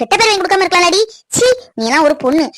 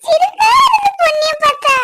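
put-on teasing girl; 10 semitones higher than usual. Girl teases Ponni.

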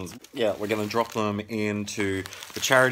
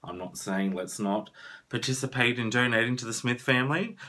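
normal talking voice

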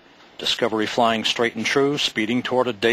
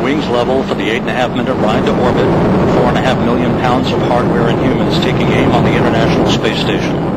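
loud launch commentary; 7 dB louder than usual. Real, not acted. Commentary over launch roar.

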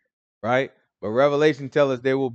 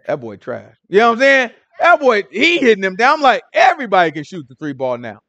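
ordinary delivery

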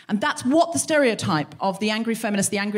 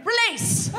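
throw command; 10 semitones higher than usual. Shown as a demonstration. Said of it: "Pull back".

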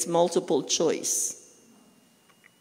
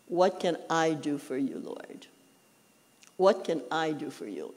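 lower, softer humble offering to the Lord; bow your voice down, offering service quietly; very humbly; 5 semitones lower, 5 dB quieter than usual. Retold or imitated. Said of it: "Now you're saying very humbly".

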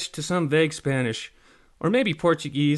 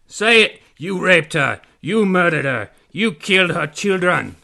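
loud, raised mock Spanish-accented yell; accented pirate yelling; 5 semitones higher, 10 dB louder than usual. A narrator's reading. Pirate demands confession, loud accented yelling. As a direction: yell each accusation with a vague Spanish accent. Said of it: "He kept yelling".

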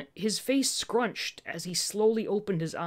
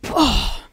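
sudden, very loud exclamation; loud surprised "oh"; 4 semitones higher, 12 dB louder than usual. Real, not acted. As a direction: blurt a startled oh. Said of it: "that was probably loud".

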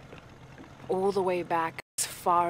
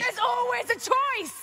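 much higher and louder, yelling; all-out angry yell; furious shout; 8 dB louder than usual. Acted. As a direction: scream it right back in his face. Quarrel, shouting him down.

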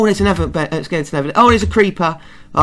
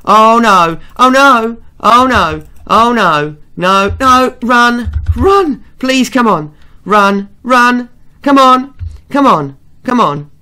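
loud, higher panicked cries of oh no and run; real panic; 6 semitones higher, 7 dB louder, slower than usual. Real, not acted. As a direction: shout it while fleeing for your life. Fleeing mobs in hardcore game.

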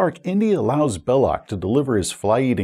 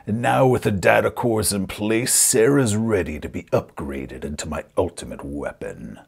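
gloating villain; 4 semitones lower than usual. Acted. Villain unveils his ultimate weapon, low gloating. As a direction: finish the evil plan low and menacing.

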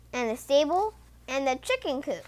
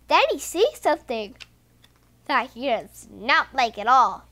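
ordinary delivery

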